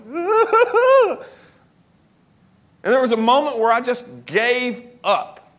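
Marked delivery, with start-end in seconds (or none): 0.0-1.2 real laugh breaking through. Real, not acted. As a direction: burst of high laughter. Laughing at himself.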